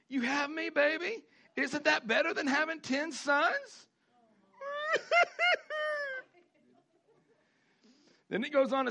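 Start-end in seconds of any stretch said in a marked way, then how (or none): 0.1-3.9 husband asks if he beats ten sons; doting husband. Acted. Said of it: "Elkanah would ask". 4.6-6.2 high-pitched squealing laugh; sudden high laugh. Real, not acted. Joke about husband.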